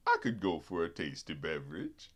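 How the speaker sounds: very low, soft character voice; Gin Rummy. Retold or imitated. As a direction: drop way down, quiet and deep. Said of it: "That's the way he fucking speaks like that".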